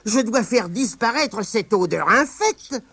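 high squeaky spider, grossed out; tiny spider holding her nose; disgusted spider. Acted. Spider Abigail to herself, squeaky disgust.

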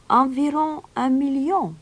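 high, put-on stranger's voice; unruffled stranger. A narrator's reading. Stranger answers the roaring mayor, high and unruffled.

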